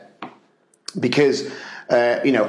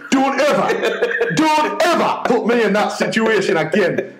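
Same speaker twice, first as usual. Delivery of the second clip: high-pitched mock outrage; exasperated, imitated protest; outraged telling-off; 7 semitones higher than usual. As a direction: scold your mate emphatically through amusement. Friend's acted outraged telling-off.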